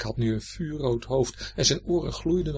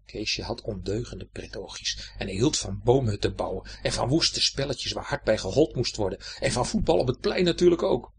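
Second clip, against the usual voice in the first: plain reading voice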